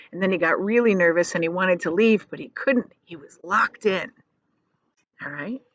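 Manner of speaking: plain reading voice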